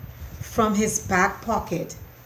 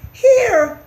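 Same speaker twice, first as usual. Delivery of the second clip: urgent mouse; 11 dB louder than usual. A narrator's reading.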